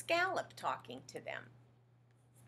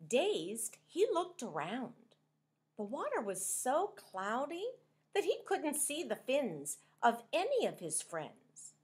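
plain reading voice

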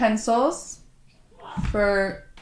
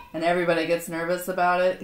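usual speaking voice

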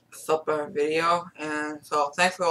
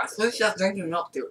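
usual speaking voice

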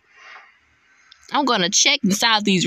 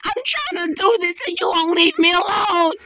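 high, fast, frightened crying shout; frantic scared yell; 8 semitones higher than usual. Real, not acted. Gamer fleeing the crazy woman, frantic scared yell. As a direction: scream it in real panic.

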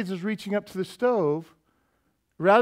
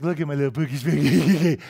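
put-on baby talk; 3 semitones lower, 3 dB louder than usual. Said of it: "They're like".

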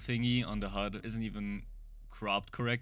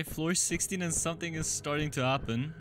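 ordinary talk, nothing marked

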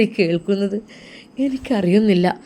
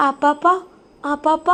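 put-on child calling; 7 semitones higher than usual.

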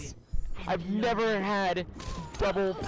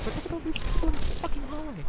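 higher, fast and louder complaint; exasperated rant; 9 semitones higher, 3 dB louder, faster than usual. Real, not acted. Gamer complaining to his friend about players in the hallway, higher fast rant. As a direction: rattle it off, fed up with the crowd.

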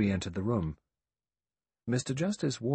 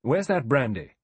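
loud, high, sharp demand; 5 semitones higher, 7 dB louder than usual. A narrator's reading. Someone calls for brandy for the gasping woman, sharp. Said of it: "said sharply".

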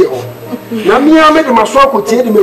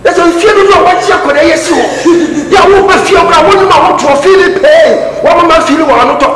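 higher and louder, laughing in scorn; angry shout; 8 semitones higher, 4 dB louder than usual. Acted. Shouting at Ɔbɛne Anthony about the house, angry. Heated quarrel, shouting at uncle.

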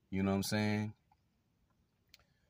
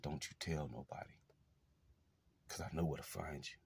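hushed threat; 4 semitones lower, 12 dB quieter than usual. Retold or imitated. Quoting abuser's threat.

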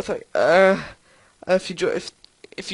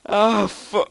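usual speaking voice

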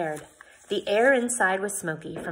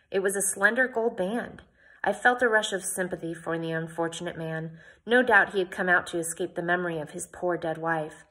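ordinary delivery, nothing marked

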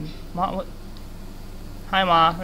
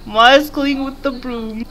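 cute cooing; 3 semitones higher, slower than usual. Real, not acted. Still cooing at character.

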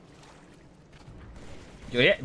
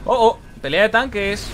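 much higher, much louder hype yell; excited shout; 11 semitones higher, 10 dB louder than usual. Real, not acted. Gamer announcing a tank fight to viewers, thrilled shout. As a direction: shout it like the match just exploded. Tank battle erupts in game.

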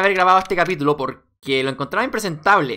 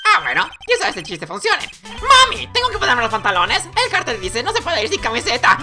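high, swooping comic clown voice; joking clown; 11 semitones higher than usual. Acted. Clown tries a joke on the court, high and hammy.

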